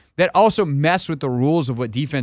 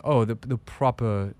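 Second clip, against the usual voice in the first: a slightly slow, softer attempt at old Charleston, sliding toward British; proper Charleston accent; 4 dB quieter than usual. Host testing a Charleston accent, comes out too British. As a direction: lightly try the proper Southern gentleman. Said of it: "no, that's too British".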